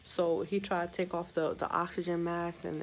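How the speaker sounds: ordinary delivery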